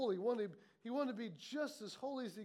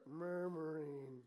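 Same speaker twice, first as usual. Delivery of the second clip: slow, low, drawn-out drone; mock murmuring; 8 semitones lower, 4 dB quieter, much slower than usual. Shown as a demonstration. Preacher names what you're doing, slow low mock murmur. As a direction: drone it low, stretching the word. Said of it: "we know what you're doing".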